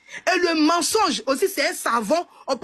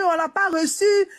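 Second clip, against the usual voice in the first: ordinary delivery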